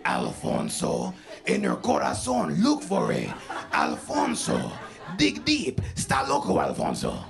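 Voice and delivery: hammed-up low taunting voice; mocking brother. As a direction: goad them in an exaggerated bass. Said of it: "Why are you saying my name like that?".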